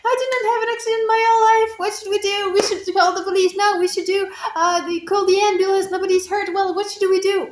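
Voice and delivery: high, fast, loud panic that settles a little by the end; panicked yelling. Retold or imitated. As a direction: blurt it all out in a frantic rush, then stay high and worked up. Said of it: "I was, like, yelling everywhere".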